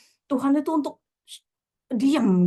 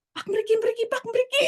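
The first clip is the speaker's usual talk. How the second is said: put-on much higher, child hailing a vendor; imitated calling out; 13 semitones higher than usual. Small child to passing vendor, high-pitched calling out. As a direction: call him over in a high voice.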